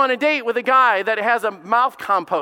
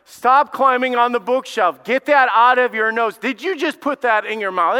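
louder mock scold at the kids; scolding parent; 5 semitones higher, 7 dB louder than usual. Preacher acting a parent scolding kids, loud mock scold. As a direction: play the fed-up parent nagging.

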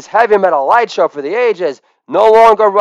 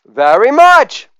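rock star thanks; 12 semitones higher, 8 dB louder than usual. Acted. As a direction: sign off like a rock idol. Elvis-style sign-off.

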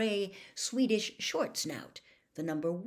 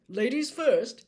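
loud, higher-pitched showman giving instructions; hearty official; 6 semitones higher, 10 dB louder, slower than usual. Acted. Bagman tells the champions their task, hearty and loud. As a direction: play a booming, excitable contest official. Voices Bagman offering the bag.

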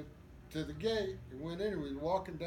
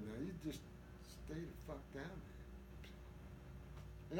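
hushed retold order; 3 semitones lower, 16 dB quieter, faster than usual. Buddy stuffing him below deck.

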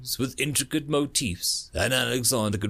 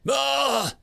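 startled cry; 14 semitones higher, 4 dB louder than usual. Acted. Alien startled by suicide.

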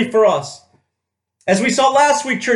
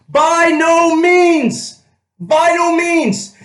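slow and loud, every word drawn out high; stretched, ringing By no means; emphatic proclamation; 7 semitones higher, 3 dB louder, much slower than usual. Acted. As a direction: hammer each word home from the pulpit. Preacher stresses Paul's answer.

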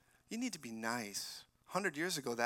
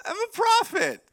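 high, loud cry of I'm a prophet; ringing shout from the pulpit; shouted declaration; 14 dB louder than usual. Real, not acted. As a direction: proclaim it at full voice. Shouted self-proclamation from pulpit.

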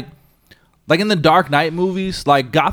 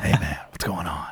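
lower, softer mock whisper of a brooding movie character; mock raspy movie voice; 10 semitones lower, 9 dB quieter than usual. Mocking the film's characters who talk right in your face, low rasp. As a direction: lean in close and rasp it low. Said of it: "they got right up in your face".

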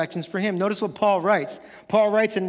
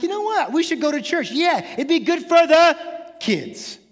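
parent deciding on church; 5 semitones higher, 4 dB louder than usual. Retold or imitated. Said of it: "and we're like".

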